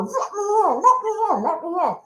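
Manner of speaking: high, pleading cries to be let in; desperate plea. Retold or imitated. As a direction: beg at the locked door. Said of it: "I'm banging on the door".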